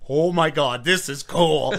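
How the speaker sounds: put-on childlike delight. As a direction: gush like a kid fan. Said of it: "the child wrestling fan in me was like".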